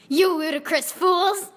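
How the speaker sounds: high, slow, put-on old wizard voice; shouting Dumbledore. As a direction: scold them like a pompous headmaster. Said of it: "he shouted".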